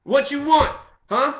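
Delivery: hammed-up street challenge; aggressive shout. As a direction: yell it at the cop in the street. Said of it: "I'm saying".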